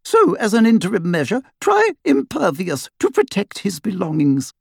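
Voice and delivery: put-on high, brisk know-it-all girl; Hermione voice. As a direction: lecture him briskly, pitched high. Hermione explaining quickly.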